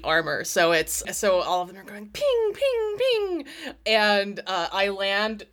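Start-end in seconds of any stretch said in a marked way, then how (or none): 2.1-3.5 bullet pings. Retold or imitated. Mimicking bullets hitting bike.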